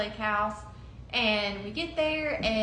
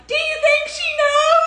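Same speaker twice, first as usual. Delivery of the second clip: loud, high, sing-song yell; shouting to her girlfriend on a racing jet ski, high and loud; yelling over the engine; 11 dB louder than usual. Retold or imitated. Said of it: "yelling as we're driving".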